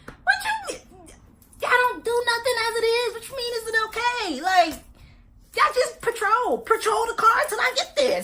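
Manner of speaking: high, exasperated disbelief with laughter breaking in; incredulous rant. Retold or imitated. Woman to the police, laughing in incredulous disbelief. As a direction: scoff at the police, half laughing. Mocking the officer's question.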